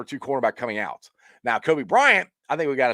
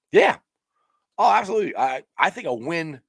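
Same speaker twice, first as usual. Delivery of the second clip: usual speaking voice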